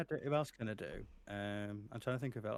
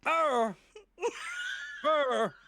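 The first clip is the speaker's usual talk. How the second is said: high, loud pained grunt; character's stun cry; stunned roar; 7 dB louder than usual. Retold or imitated. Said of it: "And then Maya's stun".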